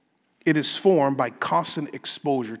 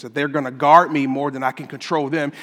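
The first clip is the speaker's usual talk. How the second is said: ordinary delivery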